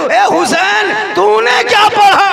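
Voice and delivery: high, loud, very slow wailing question; anguished cry. Acted. Hasan crying out to his brother Husain, loud anguished wail. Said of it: "तब हसन ने कहा".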